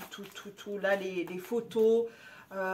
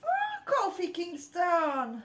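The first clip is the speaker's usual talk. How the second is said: excited; 7 semitones higher, slower than usual. Real, not acted. Delighted recognition.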